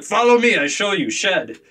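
put-on Russian kid. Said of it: "So the boy goes".

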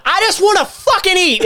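very high hammed-up yell; mock frustrated shout. Retelling his hungry meltdown, mock frustrated yell. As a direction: whine it out as a mock scream. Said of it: "Craig was like".

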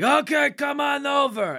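high, drawn-out mock holler; imitated scream across. Host mimicking Sam Neill's character screaming across to the other side, high holler. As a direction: call it out high and long, as if to someone across a gap. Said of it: "he screams across to the other side".

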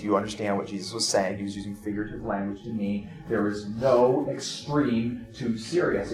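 ordinary delivery

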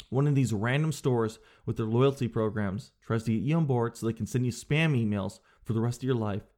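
ordinary talk

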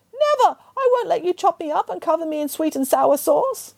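high, squeaky cry of defiance; defiant pig. Acted. Pig refusing the butcher, high and defiant. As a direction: shout it back from behind the door.

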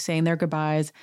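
ordinary delivery

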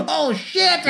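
high-pitched imitated panic; mock alarmed cry. Acting out dropped Uzi reaction.

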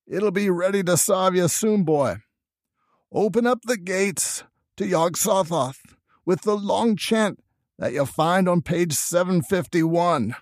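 high, slow dying rustic grandfather; Old Whately. A narrator's reading. Dying grandfather to his grandson, choking out last words in dialect. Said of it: "choke out a few words".

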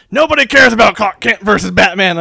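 loud, exasperated boss impression. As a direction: bellow it like a fed-up editor.

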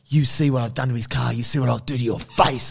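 usual speaking voice

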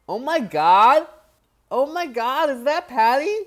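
loud, high gushing American voice; mock starstruck American fan; American accent. Imitating an American fan spotting Paddy, loud American accent. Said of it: "do your American accent there".

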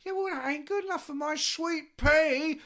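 high, loud slow shouting order; bossy shouted demand. Retold or imitated. Drummer ordering the manager to fix it, high shout. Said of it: "Nico demanding".

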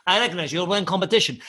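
imitated Russian fighter accent. Comic repeats the Russian's opening line, Russian accent. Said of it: "that is an exact accent".

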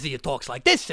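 low Italian-accented voice; talking like this. Shown as a demonstration. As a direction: drop the comic accent down low.

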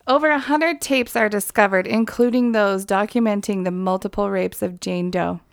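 normal talking voice

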